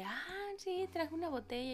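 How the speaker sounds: lightly hammed-up delight; mock excitement. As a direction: imitate everyone cooing over it. Imitates everyone reacting to the bottle.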